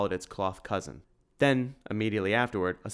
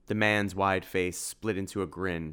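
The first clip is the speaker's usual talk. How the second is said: usual speaking voice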